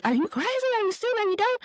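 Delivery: high, jeering taunts; mocking commenters. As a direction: mimic the online pile-on mockingly. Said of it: "they were just like".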